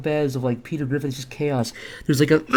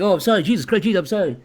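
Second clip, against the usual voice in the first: flustered apology; 6 semitones higher, 5 dB louder, faster than usual. Acted. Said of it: "And the guy's like".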